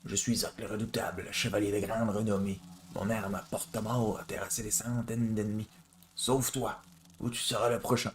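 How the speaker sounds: put-on a swaggering knight boasting in a thick Quebec accent; Zoc in Quebec accent. Streamer voicing the boastful knight to the player, Quebec accent. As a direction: puff up like a proud knight, in Québécois. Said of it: "On va lui refaire l'accent québécois".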